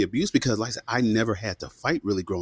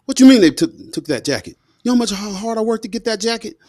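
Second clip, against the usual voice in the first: much higher and louder, indignant mom; outraged mother; 12 semitones higher, 7 dB louder than usual. Retold or imitated. Mother outraged that the kids took the jacket, much higher and louder. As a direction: blow up like a mom whose hard work got stolen.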